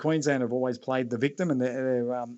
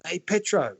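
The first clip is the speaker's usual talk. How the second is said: lightly raised mock call-out; retold yell; 5 semitones higher, slower than usual. Retelling a bloke spotting Petro on Caxton Street, mock yell. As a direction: imitate a bloke hailing someone, pitched higher. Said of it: "this bloke yells out".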